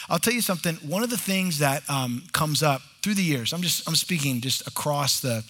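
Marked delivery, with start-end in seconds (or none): none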